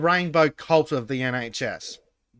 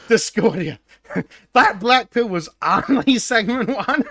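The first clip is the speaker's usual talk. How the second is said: laughing; 7 semitones higher, 6 dB louder than usual. Real, not acted. Gleeful teasing announcement.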